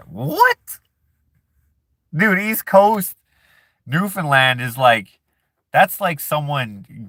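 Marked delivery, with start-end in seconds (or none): 0.1-0.8 high incredulous what; shocked, pitch shooting up; surprised. Real, not acted. Shocked at chat comment. 2.2-3.2 incredulous. Real, not acted. Protesting chat comment.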